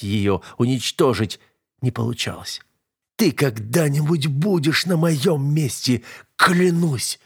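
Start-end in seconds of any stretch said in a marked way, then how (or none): 3.2-7.2 slow, higher, effortful oath; hoarse vow squeezed out through pain; strained wheeze. A narrator's reading. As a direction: force it out with the last strength. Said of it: "просипел из последних сил".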